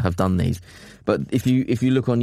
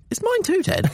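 high-pitched indignant protest. Retold or imitated. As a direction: squeak it out, offended. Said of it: "I'm like".

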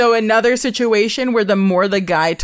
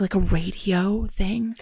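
slow awed whisper; whispered mock awe; hushed amazement; 3 semitones lower, 7 dB quieter, slower than usual. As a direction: breathe it like a secret wonder. Voicing his amazement.

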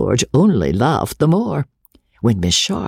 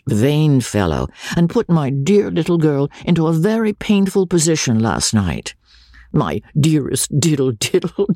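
George; 6 semitones higher than usual. A narrator's reading. George lecturing then laughing.

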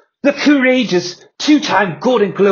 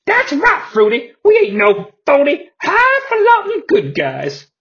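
much higher, showy wrestler voice; high flamboyant voice; 10 semitones higher than usual. Acted. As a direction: gloat in a flamboyant voice. Johnny B. Bad joins in.

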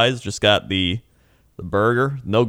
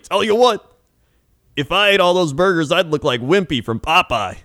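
put-on old trucker; 8 semitones higher, 3 dB louder than usual. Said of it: "He's like".

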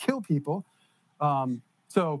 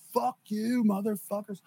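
put-on defiant drunk; 3 semitones higher, slower than usual. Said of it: "he's like".

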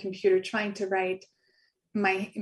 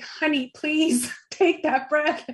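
slow, tender plea with a laugh; tender heart voice; 6 semitones higher, 4 dB louder, slower than usual. Retold or imitated. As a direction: coax gently, laughing a little, slowly. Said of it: "my heart was like".

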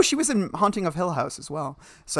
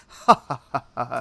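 measured, restrained mock laugh; imitating a sophisticated gentleman's laugh; dignified laugh; 3 semitones lower, faster than usual. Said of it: "a very dignified, sophisticated laugh".

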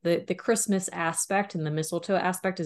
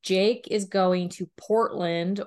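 ordinary talk, nothing marked

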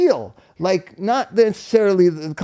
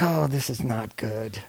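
slow, lower, softer muttered dread; inner dread; 8 semitones lower, 8 dB quieter than usual. Retold or imitated. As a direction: think it to yourself, sinking. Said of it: "And I'm like".

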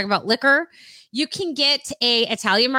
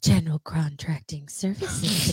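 low, slow seductive read; sexy voice; 6 semitones lower, 5 dB quieter, slower than usual. Pretend. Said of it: "Sexy voice".

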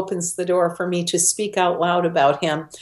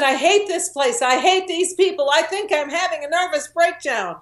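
quoting a distraught woman; 8 semitones higher than usual. Retold or imitated. Woman sobbing in a prison restroom, mildly imitated distress. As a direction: retell a stranger's breakdown, pitched up. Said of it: "She was sobbing. She was hyperventilating".

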